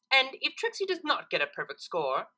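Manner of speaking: a hint of a proud showoff mare; Trixie voice. A narrator's reading.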